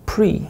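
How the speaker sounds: "pre" with an English r. Shown as a demonstration. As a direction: mistaken r in "pre" where l belongs. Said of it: "pre라고 읽으면 안 되겠죠".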